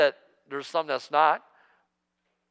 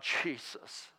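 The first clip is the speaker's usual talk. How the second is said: breathy near-whisper plea; a desperate whispered prayer to Jesus; desperate cry; 14 dB quieter, much slower than usual. Retold or imitated. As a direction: pray it in a hushed, desperate whisper. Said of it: "And I cried out".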